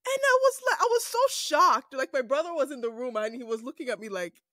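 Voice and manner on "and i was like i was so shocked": shocked. Real, not acted. Shocked retelling.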